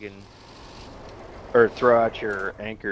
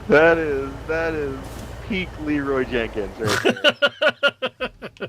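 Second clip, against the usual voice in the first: higher, slow, amused through laughter; amused laughing; 7 semitones higher, slower than usual. Real, not acted. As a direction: say it still half laughing.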